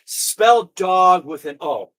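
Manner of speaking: very slow, deliberate words; slow patronizing spelling. Acted. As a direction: spell it out like to a child.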